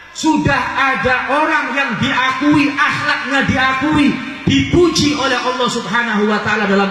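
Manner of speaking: high, full-force sermon delivery; impassioned rebuke. Real, not acted. Preacher scolding those who copy artists and footballers, impassioned. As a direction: preach it high and insistent, stretching key phrases.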